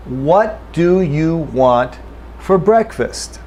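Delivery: slow, careful full form; deliberate textbook reading. Shown as a demonstration. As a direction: say each word slowly and fully. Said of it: "Let me say it slowly".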